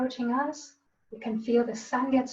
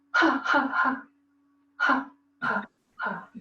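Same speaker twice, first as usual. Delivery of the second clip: demo laugh. Shown as a demonstration. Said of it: "exhale when you laugh".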